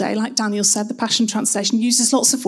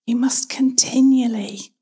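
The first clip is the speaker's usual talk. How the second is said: "continually" leaned on and lifted; stress on CONTINUALLY; slower than usual. Shown as a demonstration. Preacher repeating the verse for emphasis, stress on "continually". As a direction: make "continually" the word that carries the line. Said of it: "emphasize each word in turn".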